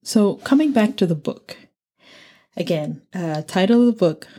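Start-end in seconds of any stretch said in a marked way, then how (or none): none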